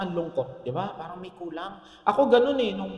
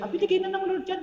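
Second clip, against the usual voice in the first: reassuring reply; 10 semitones higher, 4 dB quieter, faster than usual. Retold or imitated. Pastor's cheery reassuring reply.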